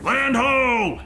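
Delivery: loud, high call from the lookout; shouting from crow's nest. Acted. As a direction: shout it to the whole ship. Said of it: "cry out".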